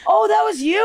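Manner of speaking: high-pitched imitation of amazed guests; mock astonishment. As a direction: squeal it high as sudden recognition. Mimics party guests' surprised recognition.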